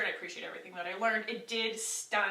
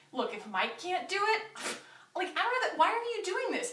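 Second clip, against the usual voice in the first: exasperated disbelief; 9 semitones higher than usual. Retold or imitated. Exasperated reply to the director.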